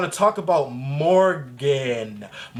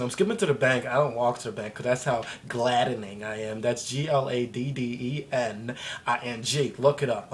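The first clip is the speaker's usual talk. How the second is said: ordinary talk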